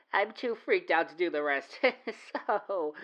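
ordinary delivery

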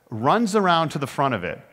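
usual speaking voice

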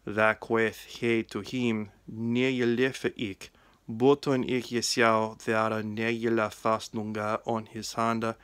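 put-on West Saxon Old English reading, steady. Shown as a demonstration. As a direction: read the Gospel in Old English, 'queth' said as kweth. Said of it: "The reading is from the Gospel of John in West Saxon".

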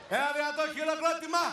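very high, loud emcee shout; applause shout. Real, not acted. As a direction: belt it out to rouse the crowd.

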